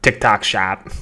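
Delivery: exaggerated Boston accent. Shown as a demonstration. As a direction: lean hard into a Boston accent. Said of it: "My Boston accent's coming out".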